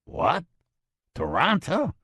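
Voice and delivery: high, stunned disbelief; what, really, Toronto; incredulous. Acted. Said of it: "he said incredulously".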